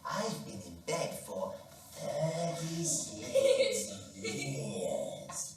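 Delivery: put-on slow, drawn-out old man. Old man answering the phone, slow and drawn out. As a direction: drag the words like a tired old man.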